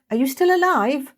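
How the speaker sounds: high, loud fearful cry; panicked call. Acted. Kesa calling through the door, panicked cry. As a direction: shout in real alarm.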